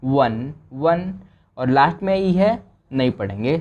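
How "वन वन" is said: teacher sounding out वन slowly. Shown as a demonstration. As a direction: draw वन out slowly, twice. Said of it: "और N को न".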